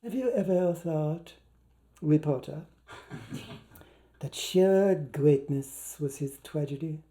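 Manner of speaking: soft, slow, easy question; unhurried soft musing; restful query. A narrator's reading. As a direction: ask it lazily, sunk in a cozy chair. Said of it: "to query restfully".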